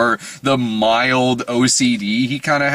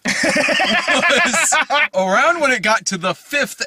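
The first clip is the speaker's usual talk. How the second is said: laughing; 13 semitones higher, slower than usual. Real, not acted. Laughing at endless movie endings.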